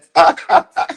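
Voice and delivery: high squeal of laughter. Real, not acted. Laughing at her own joke, high squealing laugh. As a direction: break into a shrill giggle. Bursts out laughing mid-rant.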